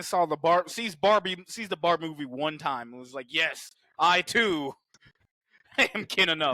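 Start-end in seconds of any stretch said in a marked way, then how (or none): 3.3-6.6 slow, put-on dopey Ken; lightly hammed Godzilla as Ken; mock Ken voice. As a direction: drawl it like a smug Ken.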